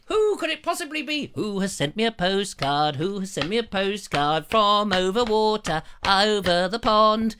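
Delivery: hammed-up guessing game voice; lightly put-on teasing voice; slowing on over the pond; mock mystery voice.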